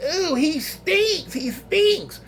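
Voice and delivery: high, slow drawn-out disgusted voice; disgusted nurse. Retold or imitated. Female nurse disgusted by the patient's smell, drawn-out. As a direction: wrinkle your nose at the smell. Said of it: "I remember one of the nurses".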